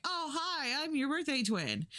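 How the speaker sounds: pitched high, eager and friendly; eager greeting. Retold or imitated. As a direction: chirp it up like meeting a twin. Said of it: "And Josie's like".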